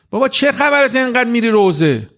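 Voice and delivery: a hint of a teasing buddy; mocking friend. University friends teasing the young man about mourning gatherings, mock scoff. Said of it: "به جوونه میگه که رفیقاشو".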